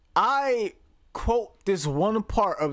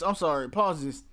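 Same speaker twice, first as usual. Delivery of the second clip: normal talking voice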